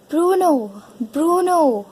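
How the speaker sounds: drawn-out, high sing-out of a name; calling out. Acted. Mother looking for lost Bruno, calling out. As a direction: call for someone out of sight.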